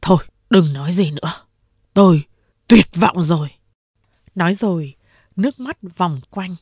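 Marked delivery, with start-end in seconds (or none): 0.0-3.5 louder, broken cry of despair; anguished big boss. A narrator's reading. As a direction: push it out like a man giving up. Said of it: "Đại Hồ nói".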